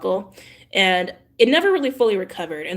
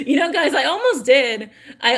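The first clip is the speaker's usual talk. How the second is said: higher, laughing admission; 6 semitones higher, 4 dB louder than usual. Real, not acted. Vlogger laughing to viewers about almost running. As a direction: laugh as you confess. Still laughing after joke.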